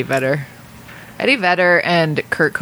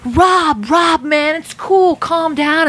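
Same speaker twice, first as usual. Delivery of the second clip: high-pitched, urgent retold plea; imitated pleading friend; 11 semitones higher, 3 dB louder, much slower than usual. Retelling a friend stepping in backstage, imitated pleading. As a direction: act out a friend talking someone down. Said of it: "went up to [name] and was like".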